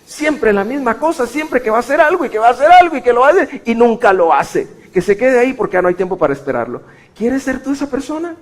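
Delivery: fiery pulpit cry, very high and loud; preaching at full force. Acted. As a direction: preach it to the back row.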